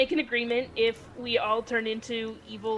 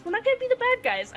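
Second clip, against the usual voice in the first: high-pitched, quick insistence; rally them in a high, hurried voice; emphatic reassurance; 11 semitones higher, faster than usual. Acted. Player reassures friends, hands on shoulders, emphatic and high.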